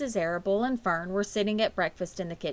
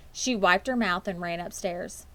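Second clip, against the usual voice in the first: ordinary delivery, nothing marked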